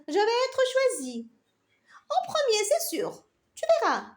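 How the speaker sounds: high, haughty little carrot voice; proud. Acted. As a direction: boast it, nose in the air. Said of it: "et dit fièrement".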